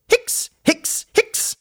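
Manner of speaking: hohe gespielte Schluckauf-Hickser. A narrator's reading. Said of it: "Sie bekam einen Schluckauf". (hohes, gespieltes Hicksen)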